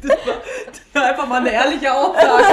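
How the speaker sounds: laut lachend gesprochen. Real, not acted. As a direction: vor Lachen fast platzend. Lacht beim Sprechen. (lachend herausgepresst)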